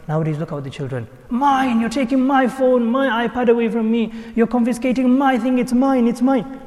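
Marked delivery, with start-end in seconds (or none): 1.3-6.6 high-pitched mock teenage protest; possessive child. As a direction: complain like a child guarding their things. Mimics possessive children complaining.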